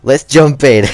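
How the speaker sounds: loud, rising hype to kick things off; slow, punchy let's-go energy; excited launch. Real, not acted. Vlogger kicking off story time for viewers, loud and hyped.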